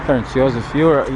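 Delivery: normal talking voice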